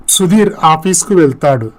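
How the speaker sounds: ordinary delivery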